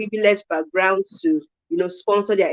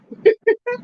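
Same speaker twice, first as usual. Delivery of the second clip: sudden high-pitched giggle. Real, not acted. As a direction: giggle at the memory. Laughs remembering a home talk.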